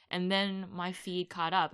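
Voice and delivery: usual speaking voice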